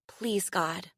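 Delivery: soft whispered plea to God; quiet prayer. A narrator's reading. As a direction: pray under her breath. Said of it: "she prayed".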